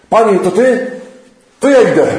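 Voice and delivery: the keen disciple rushing forward; eager call. Acted. Peter eager on the water. (podekscytowany okrzyk)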